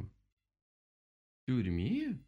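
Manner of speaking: very drawn-out rising question; incredulous query. A narrator's reading. Owl questions the rabbit's verdict, incredulous. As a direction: stretch the echo in disbelief. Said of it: "переспросила сова".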